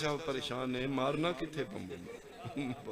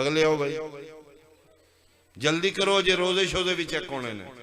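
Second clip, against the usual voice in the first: loud calling out; 3 semitones higher, 8 dB louder than usual. Acted. Angel calls the next row forward, loud and hurried.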